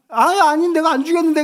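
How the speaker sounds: high, loud imitation of a small child; imitated protesting child. Three-year-old denying dad's accusation, loud imitated protest. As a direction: deny it like an accused little kid. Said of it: "애가 막 이제 울먹이고".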